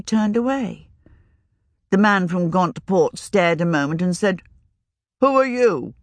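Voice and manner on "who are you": higher, curious wizard asking; surprised older wizard. Acted. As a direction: demand her name. Older wizard asks sharply.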